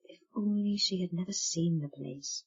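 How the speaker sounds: murmured. Acted. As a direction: murmur it under the breath. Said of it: "murmured to herself".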